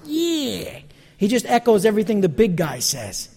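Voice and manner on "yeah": toady echo. Retold or imitated. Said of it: "he goes".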